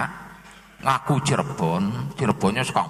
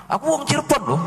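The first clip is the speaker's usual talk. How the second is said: higher, boastful claim; mock claimant; 10 semitones higher, faster than usual. A stranger bragging to locals about Cirebon, mock boastful. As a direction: claim Cirebon with a high show-off lilt.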